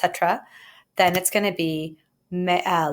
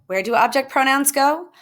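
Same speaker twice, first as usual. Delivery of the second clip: higher, louder rising question; emphatic quiz question; 7 semitones higher, 3 dB louder than usual. Shown as a demonstration. Teacher quizzing students on pronoun placement, emphatic. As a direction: ask it like a pop quiz, lifting "go". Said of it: "Let me emphasize that".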